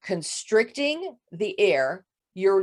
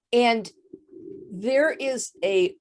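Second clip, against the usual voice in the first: ordinary delivery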